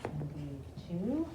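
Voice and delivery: calm, low counting voice; guided breathing. Acted. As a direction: guide them gently through breaths. Leads breathing exercise.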